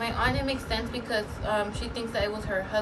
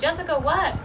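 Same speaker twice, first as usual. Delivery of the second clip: calling out; 7 semitones higher, 6 dB louder than usual. Retold or imitated. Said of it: "I was like".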